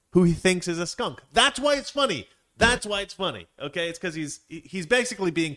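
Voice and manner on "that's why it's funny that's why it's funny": high, raised-voice exasperated point; emphatic insistence. Real, not acted. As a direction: hammer the point home, pitched up high.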